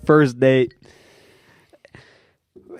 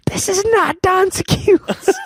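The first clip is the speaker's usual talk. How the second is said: much higher and slow, laughing through it; high, drawn-out incredulous line; amused disbelief; slower than usual. Retold or imitated. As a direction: marvel at the coincidence. Disbelief at coincidence.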